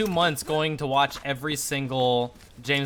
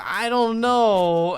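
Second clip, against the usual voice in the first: hesitant; 7 semitones higher, 4 dB louder, much slower than usual. Retold or imitated. Retold awkward refusal to woman.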